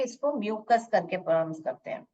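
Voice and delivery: ordinary talk, nothing marked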